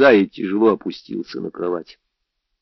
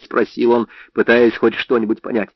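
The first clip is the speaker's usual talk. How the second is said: usual speaking voice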